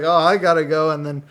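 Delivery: usual speaking voice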